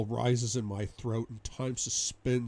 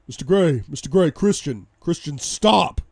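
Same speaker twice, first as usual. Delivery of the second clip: loud, raised shouts building to a long STOP; urgent calling out; 5 semitones higher, 11 dB louder than usual. A narrator's reading. Bodyguard calling to his raging boss, urgent shout. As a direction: shout to pull someone off a fight. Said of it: "I hear voices".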